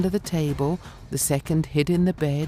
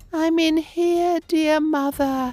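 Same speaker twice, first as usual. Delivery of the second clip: youngest goat calling; 14 semitones higher than usual. A narrator's reading. The seventh kid answers mother from the clock, lightly voiced and high. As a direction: call to mother from hiding, small and high. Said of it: "softly sounded from the clock cabinet".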